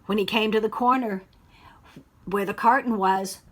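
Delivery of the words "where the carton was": usual speaking voice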